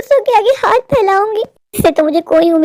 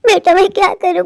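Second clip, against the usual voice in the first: ordinary talk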